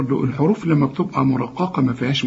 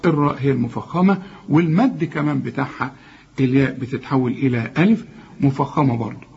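ordinary talk

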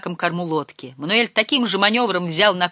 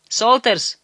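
raised-pitch brisk command; decisive order; 6 semitones higher, 4 dB louder than usual. A narrator's reading. Skipper ordering uncle to unload fish, decisive. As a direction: give a firm order, no discussion. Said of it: "решительно распорядился он".

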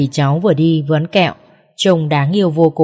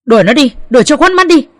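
plain reading voice

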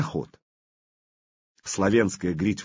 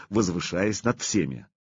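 usual speaking voice

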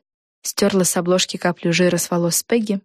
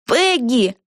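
single higher-pitched cheer; calling out; 5 semitones higher than usual. A narrator's reading. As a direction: call the name to join the cheering. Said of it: "и тоже крикнула".

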